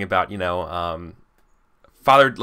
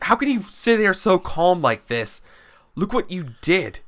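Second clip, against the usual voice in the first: angry; 9 semitones higher than usual. Acted. Angry noblewoman confronts calm rival.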